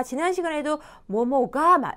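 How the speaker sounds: normal talking voice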